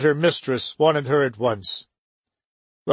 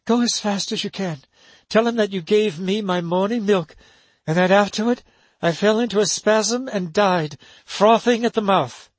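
put-on higher, urgent young woman; urgent instructions; 6 semitones higher than usual. A narrator's reading. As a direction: press her to hurry. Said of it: "Helen O'Malley said".

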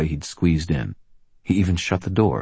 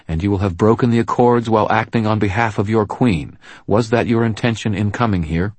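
raised, commanding lawwoman voice; firm policewoman; 6 semitones higher, 5 dB louder than usual. Acted. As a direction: stand your ground, loud and firm. Murphy pressing the Gruff.

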